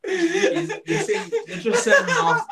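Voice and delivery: high squealing laugh while talking; can barely get words out for laughing; laughing through words. Real, not acted. Laughing at Kim while trying to speak.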